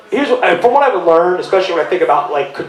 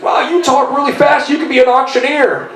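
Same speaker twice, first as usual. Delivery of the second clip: high-pitched mock wonder; imitated amazement; 8 semitones higher than usual. Quoting a man at church, exaggerated amazed exclamation. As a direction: voice someone marvelling aloud.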